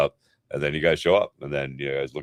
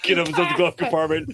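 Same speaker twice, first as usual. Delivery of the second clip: high-pitched, talking through real laughter; helpless giggly delivery; laughing; 3 dB louder than usual. Real, not acted. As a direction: crack up while getting the words out. Cracking up at own condom joke.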